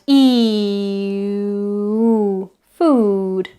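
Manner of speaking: long "E" gliding into central "oo", then "food"; halfway vowel glide. Shown as a demonstration. Teacher trying it again from E, halfway vowel glide. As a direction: stretch "E" back to "oo" and keep it for "food". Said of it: "Start at E".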